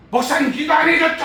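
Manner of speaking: high, loud furious accusation; shouting at the pastor in a rage; angry yelling. Retold or imitated. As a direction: yell it at the pastor, outraged. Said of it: "막 승질 고래고래".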